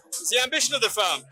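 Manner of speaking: usual speaking voice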